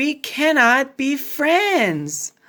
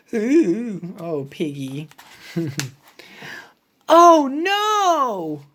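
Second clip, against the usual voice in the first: ordinary delivery, nothing marked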